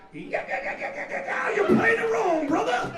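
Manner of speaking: screechy, very high rock-star correction; wild high-pitched shouted impression. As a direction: cut in with a manic frontman's squeal. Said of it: "and he goes".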